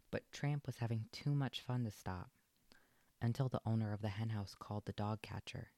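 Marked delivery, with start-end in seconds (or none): none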